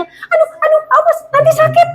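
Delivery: high anxious mother's voice; fretting mother. Retold or imitated. A mother frets over her child in drizzle, high and anxious. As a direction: cry out high like a panicky mom.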